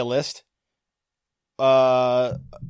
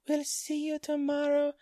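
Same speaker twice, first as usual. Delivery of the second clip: high-pitched, softer character impression; Alfred Hitchcock; 13 semitones higher, 4 dB quieter than usual. Host signing off in a famous director's voice, character impression. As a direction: put on a high, odd sign-off voice. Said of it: "Alfred Hitchcock".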